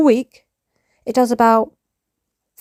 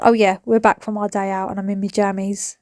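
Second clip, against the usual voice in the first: ordinary delivery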